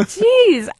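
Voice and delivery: high, loud exclamation of dawning realization; retold astonishment. Wife retelling how the quiz opened her eyes, astonished. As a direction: exclaim it as if it just hit you.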